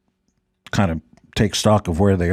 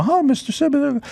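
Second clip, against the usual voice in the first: waitress voice. Retold or imitated. Waitress greeting him, retold.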